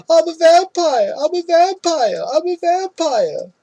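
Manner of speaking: high-pitched drawn-out mock holler, repeated like a chant; yelling. Imitating him running down the street, high, slow yelling. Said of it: "him running down the street yelling".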